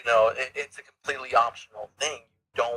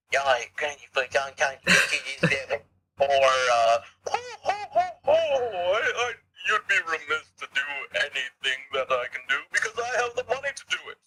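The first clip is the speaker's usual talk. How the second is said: posh voice; 2 semitones higher than usual. Acted. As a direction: gloat like a rich snob. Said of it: "do that voice again".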